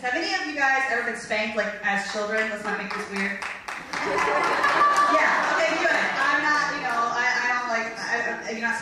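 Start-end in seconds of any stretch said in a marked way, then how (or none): 3.4-5.0 a quick, high airy laugh; high breathy giggle. Real, not acted. Laughs at own joke.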